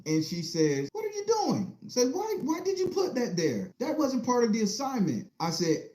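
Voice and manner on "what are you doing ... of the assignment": much higher, shocked teacher voice; a startled teacher demanding answers; teacher confronting a small boy, pitched high; teacher scolding. Retold or imitated.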